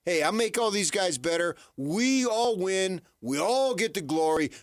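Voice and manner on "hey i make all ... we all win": normal talking voice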